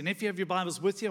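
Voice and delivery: ordinary talk, nothing marked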